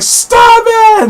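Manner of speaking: Jean's shrill cry. Retold or imitated. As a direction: cry out high, begging him to stop. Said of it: "and she's like".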